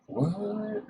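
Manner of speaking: high, startled what; surprised what. Real, not acted. Vlogger surprised by town history, high what. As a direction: squeak it out in surprise.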